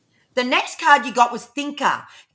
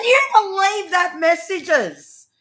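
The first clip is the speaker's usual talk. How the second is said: overjoyed; 7 semitones higher, 5 dB louder, much slower than usual. Real, not acted. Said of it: "That was a great reading".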